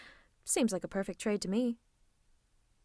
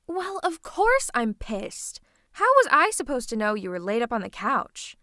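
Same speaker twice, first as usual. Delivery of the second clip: louder, indignant rant; exasperated scolding; 6 dB louder than usual. Acted. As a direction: scold with mounting agitation, hitting words hard. Villain angrily scolds absent hero.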